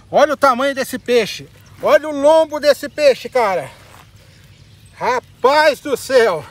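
loud, slow exclamations of amazement; excited shouting. Real, not acted. Angler showing viewers the huge fish, loud amazed shouting. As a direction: belt it out, stretching the key words. Marvelling at fish's size.

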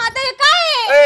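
high, loud, drawn-out cry of dismay; shrieked protest. Acted. Wife protesting husband's divorce demand, yelling. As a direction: wail it out in protest. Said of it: "ओरडू नको अजिबात".

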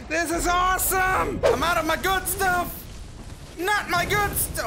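high-pitched agitated yelling; exasperated shout. Real, not acted. Player out of good stuff, exasperated shout. Exclaims at running out.

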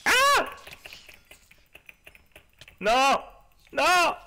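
sudden high panicked yelps; panicked cries. Real, not acted. Attacked by silverfish.

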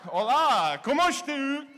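high, loud exaggerated Portuguese accent; mock Portuguese. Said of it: "You know, like".

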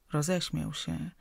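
usual speaking voice

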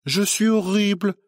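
high sneering parody of "horrible"; mocking mimicry. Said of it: "tu es horrible".

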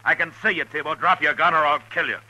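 very high, loud order to drop the gun; commanding shout. Acted. Marshal Dillon ordering Tebow to drop his gun, loud and high. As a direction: bark it out with full authority. Marshal confronting armed outlaw.

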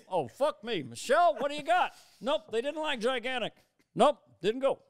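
hammed-up Obama shout; Obama impression. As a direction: Obama calling Michelle in defeat.

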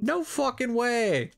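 high, drawn-out amazement; real disbelief. Real, not acted. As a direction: stretch it out in astonished disbelief. Player reacts to cat texting.

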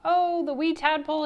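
high, loud, fond exclamation; uncle's voice. A narrator's reading. Uncle McAllister answers Miss Sievers's call, exclaiming high. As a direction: exclaim it as an uncle recalling a wee creature. Scottish uncle recalls tadpole on phone.

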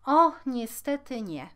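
storybook princess complaining; princess's reply. A narrator's reading. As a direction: answer with emphatic regret, voice lifted. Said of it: "odparła księżniczka".

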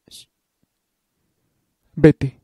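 loud, high single yell; sharp shout. Acted. Headmistress yelling at Candy to leave, loud sharp shout. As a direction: snap her away.

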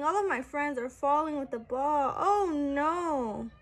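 ordinary delivery, nothing marked

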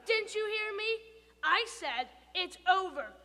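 loud, stalling before diet; firm declaration. Acted. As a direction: lay down the law. Stuart firmly cutting off tooth.

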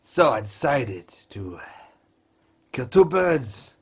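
put-on Scottish accent, halting phrases; mock Scottish commentator, pausing between phrases. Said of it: "whilst commentating in a Scottish accent".

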